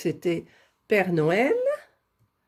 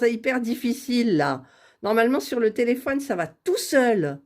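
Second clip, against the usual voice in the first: ordinary talk, nothing marked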